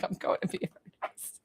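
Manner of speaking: very soft unvoiced aside; whisper. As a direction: breathe it like a secret. Quoting a chat comment aside.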